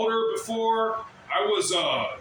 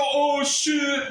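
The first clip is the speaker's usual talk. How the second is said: high, loud "Oh shit"; mock shocked yell; 8 semitones higher, 4 dB louder than usual. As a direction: demand the cry they never gave. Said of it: "Why didn't anybody go".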